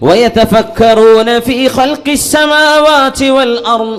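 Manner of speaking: slow, melodic tajweed chant; Quran recitation. Acted. Preacher reciting Al Imran verses to the congregation, slow and sung. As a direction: draw each verse out in devout recitation.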